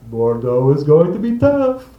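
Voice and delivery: teasing French host, slow and loud; mock host tease. Host grinning across the table, slow teasing warning. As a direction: savor the warning like a sly host.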